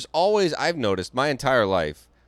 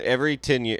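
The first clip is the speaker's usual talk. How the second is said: normal talking voice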